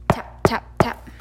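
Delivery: whispered tap tap tap; breathy knocking whisper; whispered tapping. A narrator's reading. Knocking sound read out.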